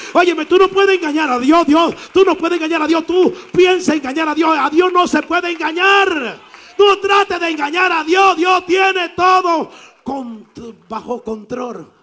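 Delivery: high, loud preacher shout; fiery pulpit shouting; preaching at full force. Real, not acted. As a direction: thunder the warning at the congregation. Preaching warning against deceiving God.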